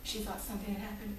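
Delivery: ordinary talk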